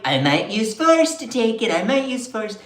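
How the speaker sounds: sly threatening Trump imitation; mock Trump. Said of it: "Then was like".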